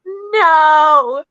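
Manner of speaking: loud high scream of no; a ghostly creature shrieking no. Retold or imitated. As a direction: scream it out like a monster. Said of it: "whatever it was screamed".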